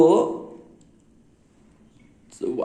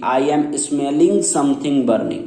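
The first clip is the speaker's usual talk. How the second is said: slow wrong example; much slower than usual. Shown as a demonstration. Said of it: "ये नहीं बोलेंगे कि".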